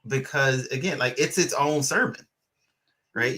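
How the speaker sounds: normal talking voice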